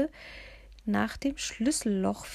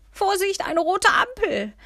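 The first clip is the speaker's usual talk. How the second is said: loud, much higher alarmed call; urgent raised-voice alert; warning cry; 12 semitones higher, 8 dB louder than usual. A narrator's reading. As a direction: shout a warning at the red light. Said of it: "rief ich".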